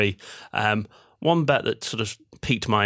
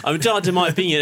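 put-on higher, sulky line in a light Australian accent; Australian accent again; 8 semitones higher, 3 dB louder, much faster than usual. Son quoting himself to his dad, light Australian accent. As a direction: replay the stroppy Aussie teen retort. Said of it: "You were neighbours there for a second".

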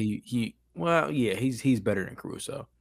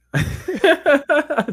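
high burst of laughter; a squealing laugh; 8 dB louder than usual. Real, not acted. Joking about a player ranking.

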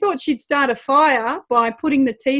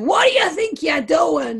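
mock Italian-accented indignation, pitched up; 4 semitones higher than usual. Dad abusing the referees, in a put-on Italian accent. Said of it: "in Italian accent".

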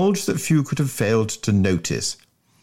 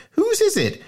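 sharp question. Acted. Johnny Bull voiced.

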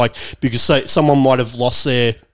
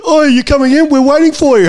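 much higher, chirpy phone voice; high mock caller voice; 13 semitones higher, 4 dB louder than usual. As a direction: imitate an eager caller. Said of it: "And I was like".

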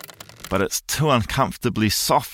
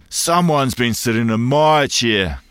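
slow, louder mild papa bear growl; 3 semitones higher, 4 dB louder than usual. A narrator's reading. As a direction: drag it out like a grumpy big bear. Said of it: "growls the Papa Bear".

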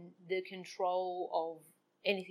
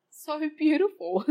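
slow and higher, laughing with joy; joyful, laughing; 8 semitones higher, 10 dB louder than usual. Real, not acted. To her dog's love, a slow joyful laughing voice. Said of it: "I am actually really full of joy".

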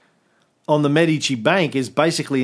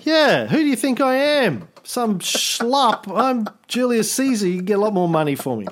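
higher, offended and boastful; indignant Caesar; 7 semitones higher than usual. Retold or imitated. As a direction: huff like an insulted emperor.

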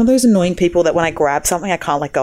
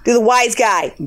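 hammy New York wise guy; New Yorker accent; 3 semitones higher, slower than usual. Shown as a demonstration. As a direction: egg them on in New Yorkese. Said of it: "Do a New Yorker accent".

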